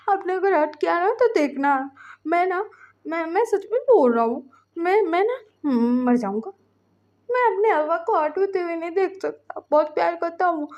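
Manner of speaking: high, plaintive plea; tearful pleading. A narrator's reading. Ving pleads for his husband not to be hurt, tearful. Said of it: "आंखों में से आंसू गिर रहे थे".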